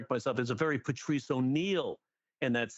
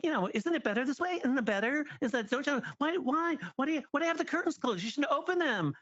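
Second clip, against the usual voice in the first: put-on much higher, sing-song nagging girlfriend; high cloying girlfriend imitation; clingy girlfriend voice; 12 semitones higher than usual. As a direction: coax him like a smothering partner. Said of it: "act out of a girlfriend who won't shut up".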